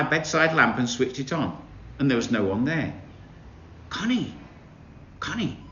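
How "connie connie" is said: hushed, higher call from below; soft calling voice. A narrator's reading. The turtle softly calling Connie. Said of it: "said the voice".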